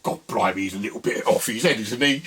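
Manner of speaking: a hint of a puzzled London geezer muttering; imagined geezer's thought. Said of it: "the geezer probably thought".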